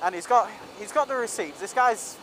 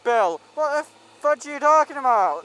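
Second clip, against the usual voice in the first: imitated angry rant, higher and louder; retold yelling; 6 semitones higher, 4 dB louder than usual. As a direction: rant like a drunk customer who feels cheated. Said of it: "he starts yelling".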